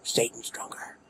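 Satan stronger whispered under breath; whispered echo. Shown as a demonstration. Gamer joking about Satan, whispered Satan stronger. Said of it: "that steroids for Lucifer?".

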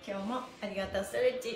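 usual speaking voice

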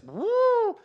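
high, loud sliding woo; imitated careening car. Car going down the road sideways, high imitated woo. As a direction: whoop like a car weaving out of control. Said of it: "going down the road like this".

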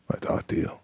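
breathy whisper, no voice. Retold or imitated. Actor pulling De Niro aside, whispering. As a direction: lean in and whisper it privately. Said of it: "I gotta talk to you".

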